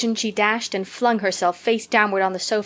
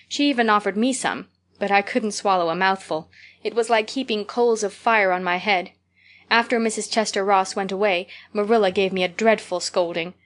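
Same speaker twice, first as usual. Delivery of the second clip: ordinary delivery, nothing marked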